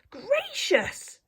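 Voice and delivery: high startled exclamation; old lady startled by a falling owl, crying out. Acted. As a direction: jump at the shock and cry it out. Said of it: "cried the old lady".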